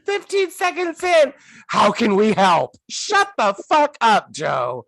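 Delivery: high-pitched comic outrage; exasperated outburst. Acted. Scolding film character for offering help, exasperated outburst. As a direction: erupt in disbelief at their stupidity.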